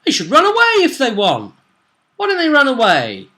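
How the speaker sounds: high, loud mock exasperation; theatrical outburst. Game master urges players to flee, loud mock exasperation. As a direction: cry it out high, then fall away.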